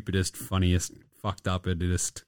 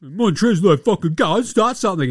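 mock tough-guy challenge; 8 semitones higher, 6 dB louder than usual.

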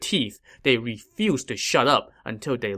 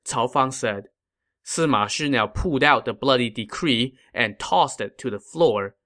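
plain reading voice

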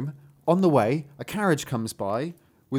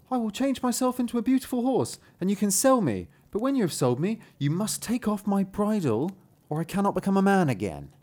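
higher-pitched storybook youth; earnest son; 5 semitones higher than usual. Acted. Son warning his father about the bridle, earnest high voice. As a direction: give careful instructions as the transforming son.

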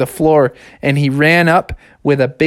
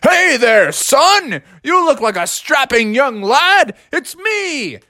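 high, hearty cartoon captain; Captain Crunch; 8 semitones higher, slower than usual. Captain popping out to greet the boy, hearty. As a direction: boom a jolly greeting, pitched up high. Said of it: "He's like".

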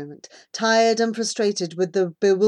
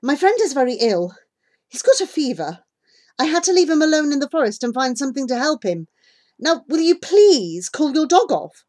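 raised voice, pleading urgently; urgent plea; 6 semitones higher, 5 dB louder than usual. A narrator's reading. Child begging the dog's owner for a sick friend, urgent plea. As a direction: build to a higher, louder demand.

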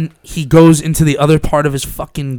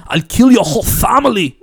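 higher, Arnold-style bad German accent; terrible German accent; 7 semitones higher than usual. Nazi threatening a rocket scientist, in a bad German accent. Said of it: "That's a terrible German accent".

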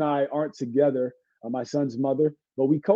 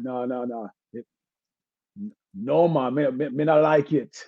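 lightly put-on Jamaican accent; Jamaican patois; 2 semitones lower than usual. Shown as a demonstration. Showing host a put-on accent, light Jamaican patois. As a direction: slip briefly into Jamaican patois. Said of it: "Jamaicans would know, like".

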